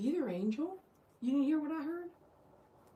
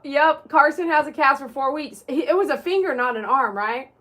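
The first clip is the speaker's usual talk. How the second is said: ordinary talk, nothing marked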